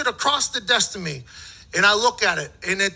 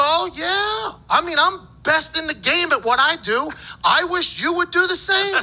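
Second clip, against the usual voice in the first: much higher, smug boast; cocky retort; 8 semitones higher than usual. Retold or imitated. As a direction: brag right back at him. Cocky comeback to the GM.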